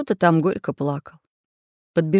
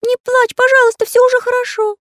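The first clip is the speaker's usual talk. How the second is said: very high, loud squeaky comforting; affectionate Карандаш; 7 dB louder than usual. A narrator's reading. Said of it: "ласково обращаясь к Настеньке".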